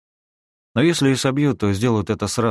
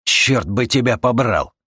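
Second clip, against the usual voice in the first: slow, loud, higher curse; cursing angrily; 6 semitones higher, 5 dB louder, slower than usual. Acted. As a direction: spit the curse out slowly. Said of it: "Выругался Юджин".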